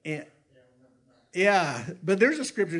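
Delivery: normal talking voice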